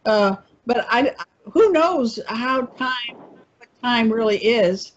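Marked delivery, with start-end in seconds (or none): none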